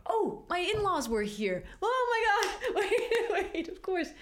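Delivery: high, loud laughter through the words; real giggle breaking in high and loud; laughing. Real, not acted. Mother recalls her in-laws, laughing high and loud.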